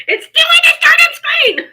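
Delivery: high, loud wailing complaint; frustrated shriek. Real, not acted. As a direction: cry it out in total exasperation. Phone still stuck.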